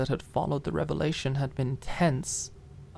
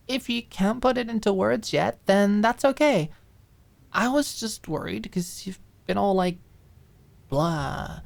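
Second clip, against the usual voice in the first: higher, bright reassuring friend voice; gentle cheerful friend; 6 semitones higher than usual. Acted. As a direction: coax her kindly, no pressure. Maud gently reassures Alicia.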